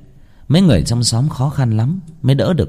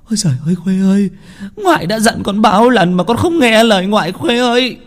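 put-on high, frantic old woman's wail; a grandmother mad with grief; 11 semitones higher than usual. A narrator's reading. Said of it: "như hóa điên hóa dại". (gào khóc như hóa điên)

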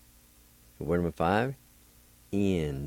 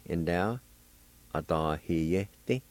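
usual speaking voice

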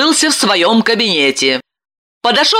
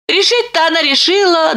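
usual speaking voice

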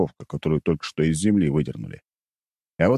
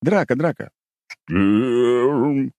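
yawning sleepy bear; 6 semitones higher, much slower than usual. Acted. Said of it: "Мишка зевнул во всю пасть".

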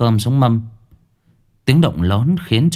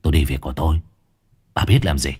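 bitten-off words; 4 semitones lower, much faster than usual. A narrator's reading. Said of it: "gằn lên từng chữ".

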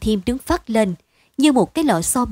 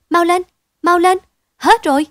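much higher and louder joyful shouts; calling out eagerly; 9 semitones higher, 7 dB louder than usual. A narrator's reading. Wife hurrying her husband home, loud excited calling. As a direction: call across the distance, waving him on. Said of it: "vừa vẫy vừa gọi chồng rối rít".

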